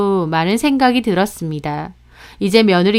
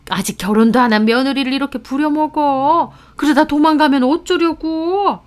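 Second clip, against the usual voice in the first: higher-pitched fussing relative in a story; 5 semitones higher than usual. Acted. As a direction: lightly voice a tutting older relative. Relative voiced in retold family scene.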